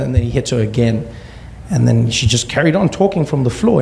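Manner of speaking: usual speaking voice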